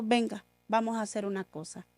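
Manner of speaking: softly, calmly reassuring; a quiet, gentle father's promise; soft and serene, no anger at all. Retold or imitated. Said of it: "con toda la serenidad".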